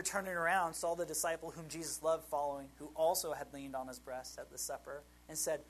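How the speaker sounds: ordinary delivery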